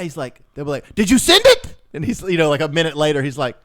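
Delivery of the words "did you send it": high, loud mock demand; demanding yell. Scammer chasing the man for money, demanding mock yell.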